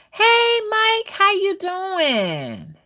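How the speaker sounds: high sing-song hello, slow; excited greeting. Real, not acted. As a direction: sing out a welcome to a viewer. Greeting a viewer.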